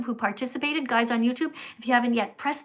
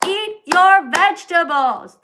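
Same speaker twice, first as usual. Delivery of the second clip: slow, higher, much louder nagging command; mock scolding order; 7 semitones higher, 10 dB louder, slower than usual. Streamer playfully ordering a viewer to eat vegetables. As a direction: boom it like a parent at the dinner table. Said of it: "although I will say".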